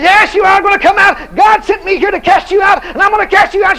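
high, loud rebuke shouted; preacher shouting at the demon; commanding shout. Retold or imitated. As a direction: drive the devil out with a yell. Retold exorcism command.

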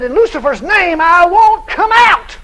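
high, very slow, shouted defiance; defiant demon voice. Retold or imitated. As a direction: let the demon refuse, loud and drawn out. Possessed woman defies the preacher.

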